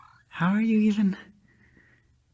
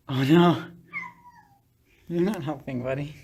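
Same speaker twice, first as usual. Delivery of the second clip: ordinary delivery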